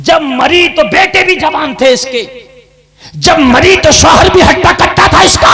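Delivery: indignant preaching outburst; indignant shout. Acted. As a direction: rail at the sons' neglect.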